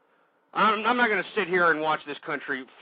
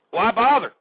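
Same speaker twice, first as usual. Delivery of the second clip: louder and higher, throwing the question out in disgust; an exasperated shout of why bother; exasperated outburst; 4 semitones higher, 6 dB louder than usual. Real, not acted. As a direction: fling it at the listeners, fed up.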